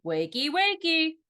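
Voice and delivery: high, slow, loud sing-song; teasing wake-up call. A narrator's reading. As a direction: stretch it out, teasing her awake. Brother waking sleepy sister.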